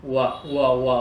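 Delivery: low "ùa" gliding from a rounded u; W glide. Shown as a demonstration. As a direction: repeat "ùa" low, like the start of "word". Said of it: "đọc hơi giống word". (âm "ùa" trầm, lướt từ "u")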